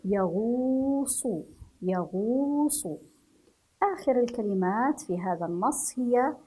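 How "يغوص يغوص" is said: يغوص with the و held long; long vowel demo. Shown as a demonstration. Teacher to pupils, stretching the و in يغوص. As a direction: draw out the و in يغوص. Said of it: "يغو مع مد الواو".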